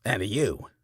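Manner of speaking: accusing preacher. A narrator's reading. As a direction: point him out loudly. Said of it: "Carey says".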